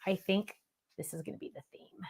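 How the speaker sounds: whispered mock confiding. Retelling what she always says on video, whispered. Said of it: "I always say".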